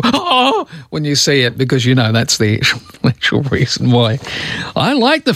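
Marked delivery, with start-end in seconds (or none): 0.0-0.7 high-pitched gasped oh; a startled, drawn-out oh in mock horror; mock alarm. Radio host acting out toilet shock, mock alarm. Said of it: "don't go".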